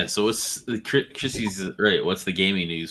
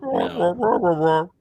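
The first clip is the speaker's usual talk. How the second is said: witch voice; 6 semitones higher than usual. Acted. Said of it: "you sound like a witch".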